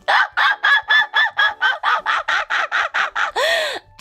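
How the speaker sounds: long, high-pitched staccato laugh; laughing fit. Real, not acted. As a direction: crack up in a high rapid-fire cackle. Cracks up at chat remark.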